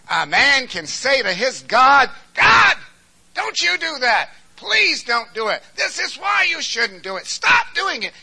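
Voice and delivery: very high, very loud shouted plea; shouted preaching. Acted. Preacher acting a man boldly telling God to stop, shouting. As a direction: cry out boldly to God, demanding. Bold prayer preached at full force.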